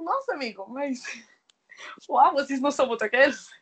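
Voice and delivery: high, laughing mock amazement; mock disbelief. Reader to the book's hero, in teasing mock disbelief. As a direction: act out faked shock at the biker guys. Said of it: "aí você fica tipo".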